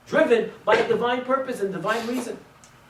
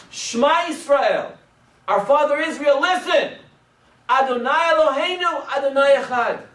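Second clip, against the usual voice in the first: crying out together; 8 semitones higher, 3 dB louder than usual. A narrator's reading. Children at their father's deathbed cry out in unison. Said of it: "they all cried out".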